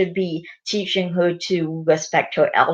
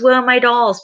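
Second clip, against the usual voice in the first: put-on higher, louder child character line; Peppa Pig; 6 semitones higher, 5 dB louder than usual. Peppa looking for her dolls, higher and louder. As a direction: call out searching for the toys. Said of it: "Peppa Pig says".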